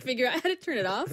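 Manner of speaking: ordinary delivery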